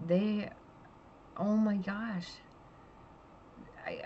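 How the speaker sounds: ordinary talk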